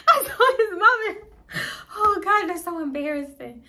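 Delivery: real embarrassed giggle; embarrassed laughing. Real, not acted. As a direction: laugh out the shame.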